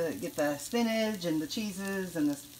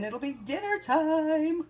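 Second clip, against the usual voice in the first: slow sing-song, pitched higher; sing-songy; 11 semitones higher, much slower than usual. Real, not acted. Cook announcing dinner in a sing-song lilt. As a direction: lilt it like a little tune. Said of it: "this sing-songy voice".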